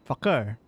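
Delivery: Fakir with a hard K in the middle; deliberate falling Fakir, K fully said; K pronounced. Shown as a demonstration. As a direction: sound out the K in Fakir. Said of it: "that's how I pronounce his name".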